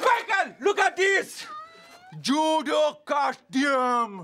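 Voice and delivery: high-pitched excited shout; excited calling. Acted. Yuri showing Michael a judo costume, high excited yell. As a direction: call him over, thrilled by the find.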